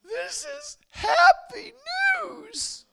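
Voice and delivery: very high, loud, drawn-out mock wail; mimicked crying. Weeping man hearing of Jesus, hammed-up joyful wail. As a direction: bawl it slowly in a squealing falsetto. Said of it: "And then he starts crying".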